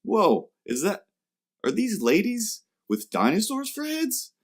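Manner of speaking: mock-amazed high voice, impressed by the art; gushing fan. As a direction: squeal it like a wide-eyed fan.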